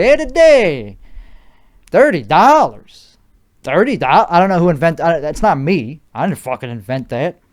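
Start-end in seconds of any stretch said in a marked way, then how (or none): 0.0-3.2 loud, high Chicago wiseguy accent; hammed-up Chicago tough-guy accent, punching the words. Shown as a demonstration. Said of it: "But when I do it".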